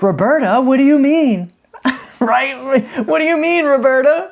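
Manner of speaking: high, loud fake-innocent whine; high indignant fake innocence to the officer; mock innocent protest. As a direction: play dumb in a squeaky outraged voice. Retold feigned innocence, laughing.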